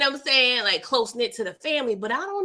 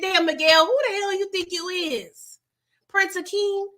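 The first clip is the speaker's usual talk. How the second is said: mock scold; 8 semitones higher than usual. Scolding Miguel in high voice.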